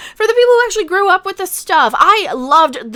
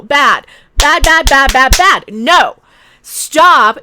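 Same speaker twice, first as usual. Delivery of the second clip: loud scolding; 7 dB louder, slower than usual. Real, not acted. Scolding the reboot like a dog.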